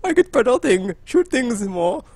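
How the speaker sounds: mock-posh professor accent; hammed-up posh professor accent; science professor accent. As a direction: put on a classy scientist accent. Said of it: "science professor voice of like".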